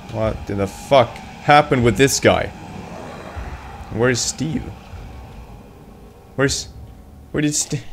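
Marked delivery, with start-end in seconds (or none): none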